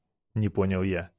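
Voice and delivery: ordinary delivery, nothing marked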